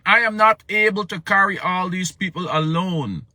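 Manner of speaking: high, loud plea; Moses pleading. Acted. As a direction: pour out his burden to God. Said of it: "He said".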